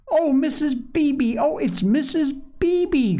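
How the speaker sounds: put-on high, drawn-out delighted child; excited cry. A narrator's reading. Davy spotting the welcome visitor, crying out in excitement. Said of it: "cried Davy".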